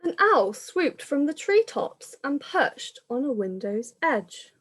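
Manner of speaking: lilting rhyming storybook read-aloud; reading to children. Acted. Reader telling children the pandemic story, lilting storytelling. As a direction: carry the rhyme along for little listeners.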